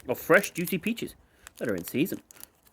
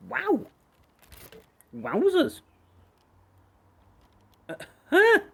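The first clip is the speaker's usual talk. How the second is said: amazed; slower than usual. Real, not acted. Amazed by the snack flavour.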